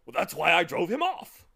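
loud, blustering boast; fierce cry. A narrator's reading. Humbug brandishing his cane, loud fierce boast. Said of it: "cried the humbug, fiercely brandishing".

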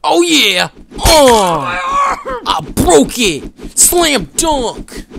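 loud, high victory yells; triumphant shout. Real, not acted. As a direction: shout it like you just scored big. Celebrating a slam dunk in game.

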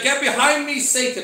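loud, high stern rebuke; preacher voicing an angry rebuke. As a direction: thunder it out as a sharp command. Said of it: "our Lord is angry".

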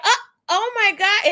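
high, startled oh my God; shocked gasp. Retold or imitated. Girl seeing his missing teeth, shocked and laughing. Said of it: "she made, like, a little noise".

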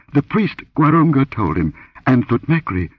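high-pitched, mildly breathless answer; gasped reply. A narrator's reading. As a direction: answer as if just shaken. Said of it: "she gasped".

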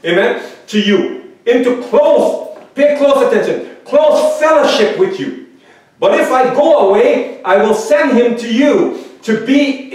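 high, loud, fervent sermon voice; fervent preaching. Acted. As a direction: preach it out to the congregation with fire. Preaching at full force.

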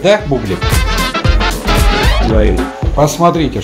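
ordinary delivery

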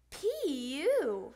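loud, drawn-out reaction to a stink; disgusted. A narrator's reading. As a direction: recoil from a bad smell. Reacting to a bad smell.

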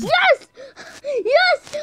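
high loud triumphant yes; cheering. Real, not acted. Gamer cheering a lucky escape. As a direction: shout with joy.

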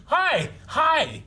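high eager dog greeting; excited dog voice. Retold or imitated. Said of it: "He's like".

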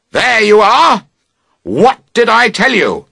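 high, loud triumphant accusation; calling out together. A narrator's reading. Both campers calling out across the fallen tent, loud accusation. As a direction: call it out as if proven right. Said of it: "you both call out".

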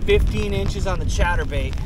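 loud, high, proud yell over wind; shouting over wind. Real, not acted. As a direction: shout the catch over the gale. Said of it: "can hear me over the wind".

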